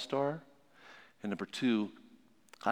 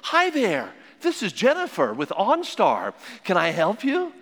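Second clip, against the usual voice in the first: higher, louder cheery call-centre greeting; bright helpful operator picking up; 7 semitones higher, 5 dB louder than usual. Retold or imitated. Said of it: "then we hear a voice".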